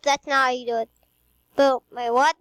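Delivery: ordinary talk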